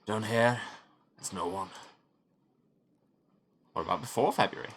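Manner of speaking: usual speaking voice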